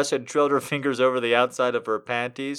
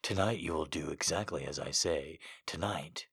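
low, soft, possessive growl; 9 semitones lower, 13 dB quieter than usual. Acted. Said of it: "he growled".

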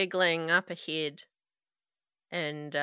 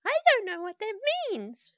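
put-on creepy little girl=85; 12 semitones higher, faster than usual.

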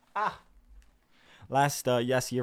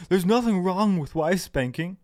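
higher, louder, drawn-out protest; mock protesting commenter; 7 semitones higher, 8 dB louder than usual. Quoting a commenter defending himself, in loud mock protest. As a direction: imitate an indignant objection, slow and raised.

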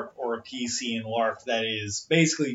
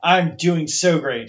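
sarcastic; 3 semitones higher, 6 dB louder than usual. Real, not acted. Sarcastic reply to teasing.

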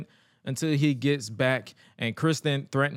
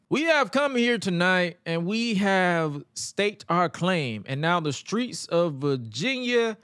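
put-on slow, drawn-out speech in an uncertain, maybe Italian accent; higher, stretched-out mock accent proclamation; mimicked odd accent; 4 semitones higher, slower than usual. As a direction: imitate a wrestler's puzzling accent on the mic, slowly. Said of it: "I'm gonna try to mimic it".

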